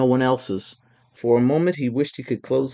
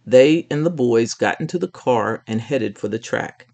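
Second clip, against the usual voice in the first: ordinary delivery, nothing marked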